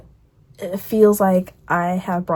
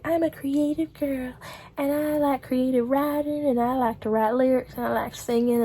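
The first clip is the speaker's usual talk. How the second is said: higher, softer mock wannabe star; starry-eyed younger self; 5 semitones higher, 4 dB quieter than usual. Young dreamer telling of her creative hopes, mock starry-eyed voice. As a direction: play an aspiring creative girl, gently sending her up.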